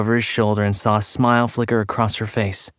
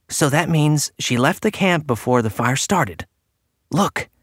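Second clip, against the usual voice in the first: higher-pitched, firm deduction; sudden firmness; 5 semitones higher than usual. A narrator's reading. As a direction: state it firmly in a raised pitch. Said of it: "Clark said with sudden firmness".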